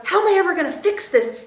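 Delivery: high, fast fretting; wife panicking over a yelling husband, fast and high; frantic worry. Retold or imitated. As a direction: rush it like a panicked inner voice. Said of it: "instead of going to".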